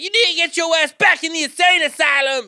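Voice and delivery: high, loud ranting shout; hammed-up tirade at an empty street; imitated yelling. Homeless woman yelling at no one, high screech. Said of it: "yelling, and there's no one".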